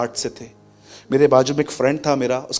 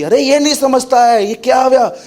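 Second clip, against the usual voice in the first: higher, louder, heated retort; complaining wife; 7 semitones higher, 4 dB louder than usual. Retold or imitated. Wife firing back at her husband, heated and high. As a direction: snap back like an annoyed wife. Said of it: "और उसकी wife भी".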